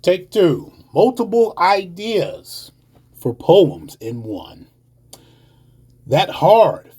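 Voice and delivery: rhythmic, emphatic poetry performance; spoken-word poem. Acted. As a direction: drive through the images, punching stressed words.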